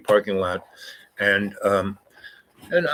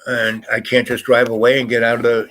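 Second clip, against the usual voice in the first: ordinary talk, nothing marked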